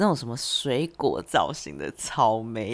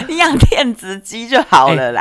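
laughing; 8 semitones higher, 12 dB louder than usual. Real, not acted. Joking banter, laugh heard.

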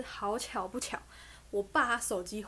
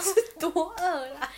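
teasing; 8 semitones higher, 6 dB louder, slower than usual. Real, not acted. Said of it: "teasing remark".

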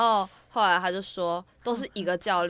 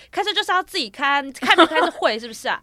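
higher, louder, 开车就是要自己开 hammered out; angry mom shouting; 7 semitones higher, 10 dB louder than usual. Retold or imitated. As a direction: let mom's fury peak on 就是要自己开. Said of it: "他就整个很火大".

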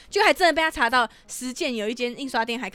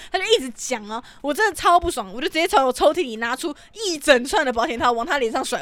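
usual speaking voice

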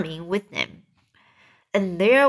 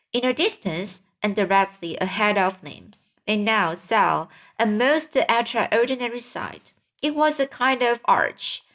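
usual speaking voice

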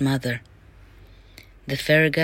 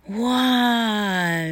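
long, higher-pitched wow; marvelling at fairy magic; storyteller's wonder; 5 semitones higher than usual. A narrator's reading. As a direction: draw it out in amazement.

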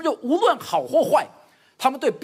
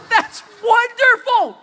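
higher, louder, slow laughing cheer; hammed-up praise; 12 semitones higher, 10 dB louder, much slower than usual. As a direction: overdo the praise as a joke. Father overpraises a tiny contest. (夸张地大声欢呼)

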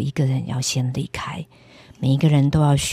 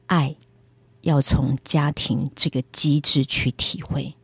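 slow and weighty; slower than usual. Retold or imitated. God's voice speaking to her alone, slow and weighty. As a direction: give each word great weight. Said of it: "那个速度比我现在讲的还慢，每个字带着一种很大的力量".

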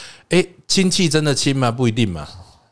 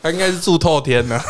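laughing; 6 semitones higher than usual. Real, not acted. Keeps laughing at own joke.

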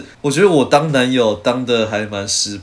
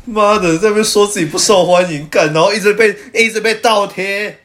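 mock outrage; 9 semitones higher, 4 dB louder than usual. Mock outrage at friend's popularity.

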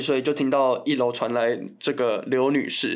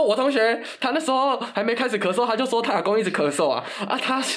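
imitating brother; 7 semitones higher than usual. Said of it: "他说".

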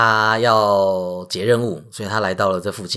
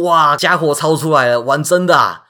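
alarmed excitement; 5 semitones higher, 6 dB louder than usual. A narrator's reading. Weapons drawn, Hercules alarmed.

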